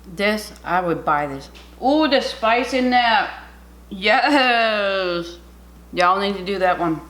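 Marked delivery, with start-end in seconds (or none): 1.8-5.4 loud, slow, high delight; thrilled spicy reaction; excited. Real, not acted. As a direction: savor it out loud. Reacting to spice.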